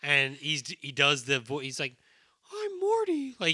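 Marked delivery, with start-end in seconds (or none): 2.5-3.3 very high, tight-jawed dummy voice; ventriloquist voice. Retold or imitated. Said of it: "it's the ventriloquist voice".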